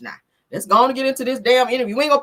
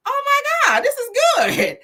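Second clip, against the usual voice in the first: retold delight; 5 dB louder than usual. First taste of pound cake.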